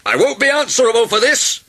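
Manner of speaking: high, loud indignant yell; outraged doctor, shouting high; yelling specialist. A narrator's reading. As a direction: yell your protest at the top of the voice. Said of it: "yelled an ear, nose and throat specialist".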